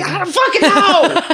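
high, hammed-up holler; exasperated yell. Friend asks other side of what, high exasperated yell. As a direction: throw up the answer in a high mock shout. Acting exasperated reply to riddle.

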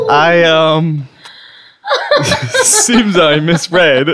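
laughing through the words; cracking up mid-sentence. Real, not acted. Host laughing at his joke.

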